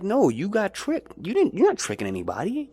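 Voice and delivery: usual speaking voice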